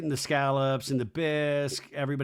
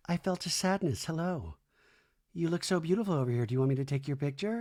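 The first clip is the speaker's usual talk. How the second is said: quick, soft mimic of Nia; light mock of a dreamy friend; mock airy Nia; 2 semitones higher, 4 dB quieter, faster than usual. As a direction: breeze through it airily. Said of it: "Nia's like".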